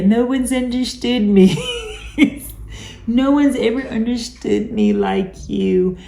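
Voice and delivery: breathy-free put-on lovesick voice breaking into a laugh; hammed-up clingy partner, laughing at it; mock lovesick voice. Narcissist flattering a new partner, mock lovesick with a laugh. Mocking narcissist's love-bombing line.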